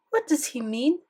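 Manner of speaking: put-on puzzled grandmother with French accent. A narrator's reading. Grandmother puzzling over the email, French accent. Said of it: "Grandmere had a thick French accent".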